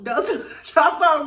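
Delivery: cracking up while quoting the warning; laughing mock warning. Retelling Meredith's line to viewers, laughing. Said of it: "I was like".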